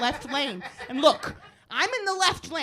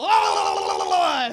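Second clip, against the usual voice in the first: high, loud drawn-out yell; imitated enraged scream; 5 semitones higher, 5 dB louder than usual. Comic voicing passing driver, raging yell. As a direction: mimic a driver screaming past. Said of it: "just so he can go".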